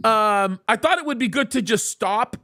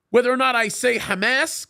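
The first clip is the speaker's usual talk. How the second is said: ordinary talk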